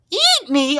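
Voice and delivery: high, loud outraged shriek. A narrator's reading. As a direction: shriek it in disbelief. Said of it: "Arnie shrieked".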